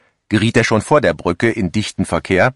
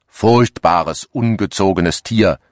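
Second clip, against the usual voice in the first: ordinary delivery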